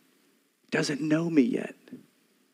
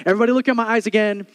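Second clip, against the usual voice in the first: loud, high, insistent preacher's cry; full-force preaching; 8 semitones higher, 5 dB louder than usual. Acted. Pastor to congregation, preaching loud and high. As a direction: pound home God's love to the crowd.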